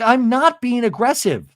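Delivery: high-pitched mock protest; retold defensive denial. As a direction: imitate someone insisting on innocence. Acted denial.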